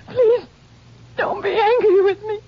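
wife pleading with lawmen not to be angry; hysterical pleading. Acted. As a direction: beg them, near breaking. Caught wife begs, hysterical.

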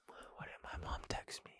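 voiceless, barely audible whisper; hushed aside to yourself; whispering to self. Real, not acted. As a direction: murmur it under your breath while checking a message. Reads phone text to himself.

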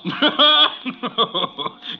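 scornful chuckle at a nervous man; mocking laugh. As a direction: laugh him off, amused and superior. Scornful laugh at cold feet.

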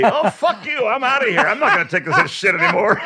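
high-pitched indignant walkout; imitated shouting woman. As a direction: imitate a fed-up woman storming off. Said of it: "I hear the woman say".

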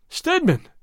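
sudden high-pitched call; crying out. A narrator's reading. Gordon jumps up and calls to Stedman, crying out. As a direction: call the name out in alarm. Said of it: "cried Gordon, jumping to his feet".

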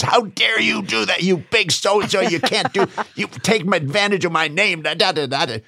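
high-pitched, indignant imitation of a rant; hammed-up outrage, running into gibberish; mock furious tirade. Gary jumps in the teller's face, mock fury.

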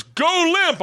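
high urgent command; trainer's high urgent order. Retold or imitated. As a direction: snap the order. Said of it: "and he goes".

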